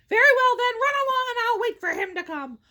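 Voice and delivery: lightly voiced troll, high and loud; troll's dismissal. A narrator's reading. Troll letting the middle goat run along, mild command. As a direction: send the goat off loudly as the troll. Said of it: "said the troll".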